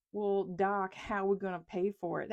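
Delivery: lightly imitated Louisiana Southern accent; a hint of an East Texas Southern accent, folksy. Senator questioning the economist at a Senate hearing, light Southern accent. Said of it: "That's his accent".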